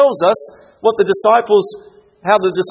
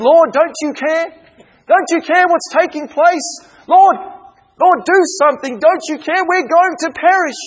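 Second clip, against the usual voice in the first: high, frantic cry of terrified disciples; panicked plea; 9 semitones higher, 4 dB louder than usual. Acted. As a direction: plead with the Lord as the boat sinks. Voices panicking disciples in storm.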